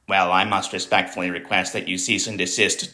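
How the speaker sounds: mocking timid Democrats with a prim, meek voice; meek compliant politician. Mocking the meek Democrats' posts, in a prim, timid voice. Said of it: "meek, compliant".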